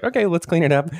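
high-pitched, easygoing reassurance; unfrustrated. Retold or imitated. Parent to child after dropped baking soda, unfrustrated. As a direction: lightly sing it out, keeping it unfrustrated. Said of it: "I wasn't frustrated".

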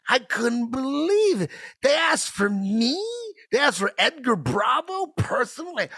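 excited. Retold or imitated. Disbelief after the call.

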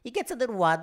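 high helium take on an impression; botched impression gone squeaky; Michael Caine. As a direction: jump up in pitch, getting wilder. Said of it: "You just kept taking more helium in".

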